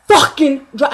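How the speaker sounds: loud yelled order; yelling. Retold or imitated. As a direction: shout him away in fury. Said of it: "I started yelling".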